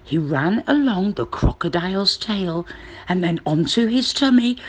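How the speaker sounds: plain reading voice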